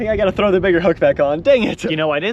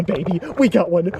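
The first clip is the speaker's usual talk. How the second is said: normal talking voice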